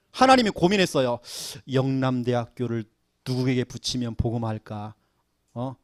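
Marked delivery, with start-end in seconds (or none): none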